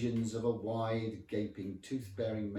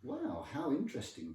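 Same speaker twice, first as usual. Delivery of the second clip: higher, falsely impressed tone; feigned interest; 7 semitones higher than usual. Acted. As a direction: cover horror with polite interest. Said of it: "male pride morphed it into a".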